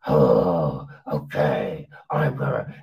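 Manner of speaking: very low, rough gravelly imitation; mock gravel voice. Reviewer mimicking the captain later in the film, in a low gravelly voice. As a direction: growl it out in an exaggerated deep rasp. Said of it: "develops this whole gravel weirdness to the voice".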